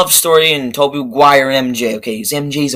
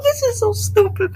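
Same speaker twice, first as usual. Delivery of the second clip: mock sobbing; 5 dB quieter than usual. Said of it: "And I'm like".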